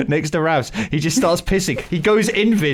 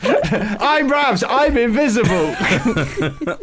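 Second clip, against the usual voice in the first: laughing; 6 semitones higher, much slower than usual. Pretend. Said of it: "Just Ravs trying to sneak".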